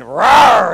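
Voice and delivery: loud, drawn-out imitated roar; big mock monster growl; Hulk roar. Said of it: "he'll say".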